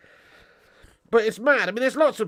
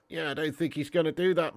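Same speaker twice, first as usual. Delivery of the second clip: ordinary talk, nothing marked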